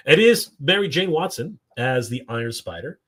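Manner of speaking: ordinary talk, nothing marked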